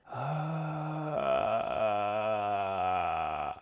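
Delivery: clear, projected, extroverted sustained vowel; projected sigh. Shown as a demonstration. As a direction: open sigh anchored in the neck. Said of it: "my voice completely changes into something much more projected".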